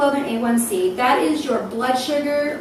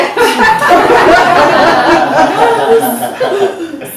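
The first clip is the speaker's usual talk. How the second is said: long, loud hammed-up wailing; mock sobbing; 6 semitones higher, 10 dB louder than usual. Patient asked about the appointment, mock sobbing. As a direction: bawl instead of answering, for comedy.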